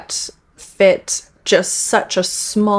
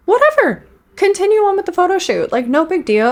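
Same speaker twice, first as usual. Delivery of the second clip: higher, breezy and dismissive; breezy shrug; 11 semitones higher, 3 dB louder than usual. Pretend. Reactor shows how he should have reacted, breezy shrug. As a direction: toss it off like it's no big deal. Acting out the calm response.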